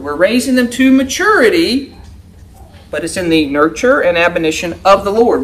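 ordinary delivery

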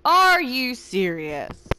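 slow, drawn-out disbelief; exasperated. Real, not acted. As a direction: stretch it out, fed up. Repeats after dying.

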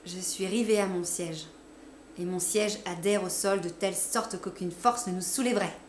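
usual speaking voice

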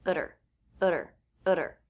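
"Utter" with a tapped T and dropped H; flap T, at her. Shown as a demonstration. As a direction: tap the T, run into "er". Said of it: "That means the T will be a flap T".

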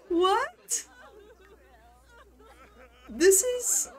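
very high, loud squeal through laughter; incredulous hysterics. Real, not acted. Player stunned by a sudden death at the bar, incredulous hysterics. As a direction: squeal in disbelief, losing it laughing.

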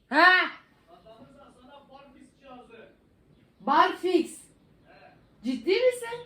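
high, loud burst of disbelief; astonished. Real, not acted. Vlogger reacting to a pull-up bar prize, astonished. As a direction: exclaim in shrieking disbelief as the pitch leaps.

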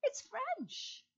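high, bright announcement of the answer; grand reveal. Acted. Host revealing the answer to children, excited. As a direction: reveal it like a game show host.

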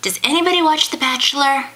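calling out. Real, not acted. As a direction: call out to anyone listening. Calling out to viewers.

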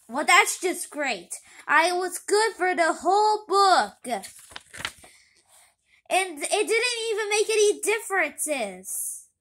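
loud, high, slow bitter complaint; bitter outburst. Acted. Pity complains to Kitty about jail, loud and high. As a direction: vent loudly at the unfairness.

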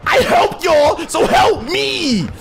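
high, loud, indignant plea; desperate shout. Real, not acted. Player demanding teammates return favour, high desperate yell. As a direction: yell for help, stretching the end.

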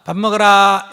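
put-on calling the kids to dinner, loud; loud mother calling kids to eat. Shown as a demonstration. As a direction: shout it across the house. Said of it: "이러면 안 된다는 거예요".

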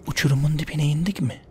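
ordinary delivery

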